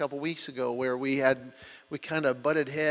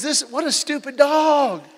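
much higher and louder, mock exasperation; exasperated retold remark; 11 semitones higher, 7 dB louder than usual. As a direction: throw it up high, fed up with the dog. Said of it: "And he says".

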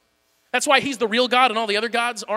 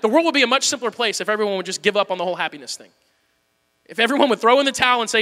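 ordinary delivery

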